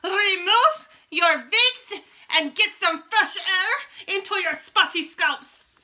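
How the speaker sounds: slow, high, loud witch bellow; Grand High Witch shouting. A narrator's reading. Witch commanding her audience to unwig, shouting slowly. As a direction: bark it at the hall like a witch queen. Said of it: "she shouted".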